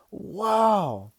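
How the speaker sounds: loud, high, drawn-out exclamation; amazed. Acted. Kid hearing dad's big news, loudly amazed. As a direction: let it burst out in awe.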